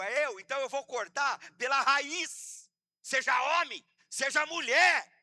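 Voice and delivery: loud preacher's declamation; shouted, emphatic owning-up; forceful confession. Acted. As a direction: hammer each admission out at full voice.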